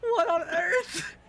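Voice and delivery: very high, drawn-out bewildered cry; shocked outcry. Acted. Man suddenly scooped up by strangers, high shocked outcry. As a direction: cry out high and slow in disbelief.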